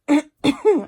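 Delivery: loud hacking cough; chesty cough. Real, not acted. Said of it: "Outside's about to kill me".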